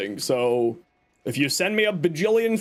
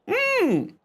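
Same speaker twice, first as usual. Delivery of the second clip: satisfied mmm. Real, not acted. After drinking water.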